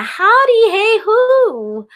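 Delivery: high, bright sing-song hey hoo; a squeaky little mosquito cheer; happy mosquito call. Acted. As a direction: toss it up high like a cheerful bug.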